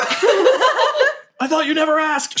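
very high, slow, drawn-out yell; orc calling back. Acted. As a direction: holler back delightedly, stretching the words. Orc answers the yelled plea.

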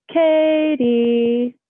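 high, drawn-out eerie sing-song; sing-songy eerie call. Retold or imitated. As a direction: call it out long and high, dropping a step. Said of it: "so sing-songy and terrifying".

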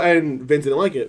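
ordinary delivery

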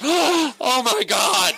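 gasped, high, loud mock horror; gasp, then a high-pitched shocked cry; mini scream. Comic imitating the producer's shock at a hit dog, mini scream. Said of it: "did, like, a mini scream".

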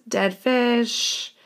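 normal talking voice

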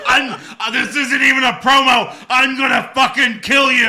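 high, loud mock rage; raging gamer. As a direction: shout it like a wrestling promo. Imitating wrestler's screaming promo.

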